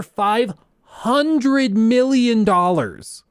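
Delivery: "hundred million dollars" drawn out high and slow; incredulous emphasis. Real, not acted. Appalled at the money wasted.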